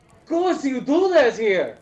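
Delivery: high, slow, scandalised cry; mock outraged exclaim. Character scandalised at a crude gesture, exclaiming. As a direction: act shocked and appalled.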